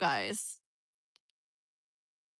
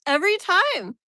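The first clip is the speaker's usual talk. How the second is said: loud, high burst of frustration; exasperated cry; 8 semitones higher, 9 dB louder than usual. Real, not acted. Streamer fed up with the sun returning, exasperated. Sun spoils it yet another time.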